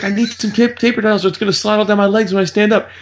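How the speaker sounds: high, urgent imitation; mock panic. Dad begging for paper towels, mock panic.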